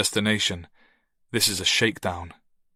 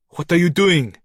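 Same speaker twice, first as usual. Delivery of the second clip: higher, louder cry of alarm; 8 semitones higher, 6 dB louder than usual. A narrator's reading. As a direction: snap out in sudden fright. Noah alarmed, phone taken.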